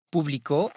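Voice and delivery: Publico with the stress on CO; stress on last syllable. Shown as a demonstration. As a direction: say Publico as pu-bli-CÓ, final syllable strongest. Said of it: "avec accentuation sur le O". (Publico accentué sur la dernière syllabe, CO)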